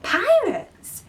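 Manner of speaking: high startled cry; alarmed exclamation. Acted. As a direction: gasp the word in shock. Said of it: "exclaimed Wendy".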